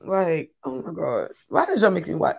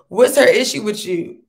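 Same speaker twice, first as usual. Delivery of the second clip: ordinary delivery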